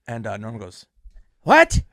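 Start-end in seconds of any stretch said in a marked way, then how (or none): 1.4-1.8 sudden high, loud yelp; imitating a buddy's alarmed yell; startled shout. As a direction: jump out of your skin with one word. Norm startled by gun joke.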